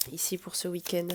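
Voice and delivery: ordinary delivery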